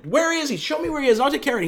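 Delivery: high, loud, frantic begging; distraught pleading. Retold or imitated. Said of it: "she's struggling and crying".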